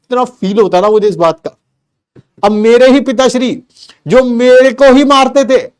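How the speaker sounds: ordinary talk, nothing marked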